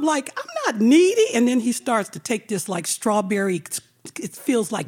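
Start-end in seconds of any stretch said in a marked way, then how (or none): 0.4-1.3 high indignant denial; mock offended denial. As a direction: deny it, offended.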